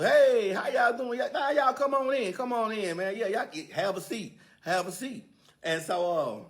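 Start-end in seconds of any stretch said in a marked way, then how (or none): 0.0-5.2 hammed-up cheerful host voice; overeager welcome. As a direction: gush over the guests at the door. Joe eagerly welcoming guests.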